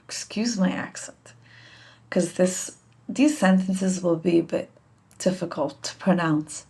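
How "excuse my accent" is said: whispered aside. Real, not acted.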